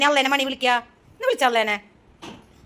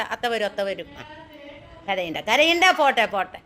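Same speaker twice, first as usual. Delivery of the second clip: soothing; 8 semitones lower than usual. Real, not acted. Mother soothing crying child.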